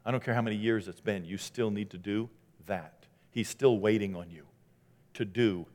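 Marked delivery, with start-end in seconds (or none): none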